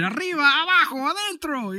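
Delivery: mock beach tequila hawker. Host recalling a Rosarito shot guy, mock chant. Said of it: "guy with seven hats".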